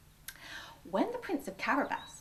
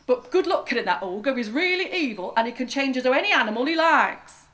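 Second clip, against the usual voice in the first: loud, higher warning; workers warning; 4 semitones higher, 10 dB louder than usual. A narrator's reading. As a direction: warn him loudly about the ogre. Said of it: "they said".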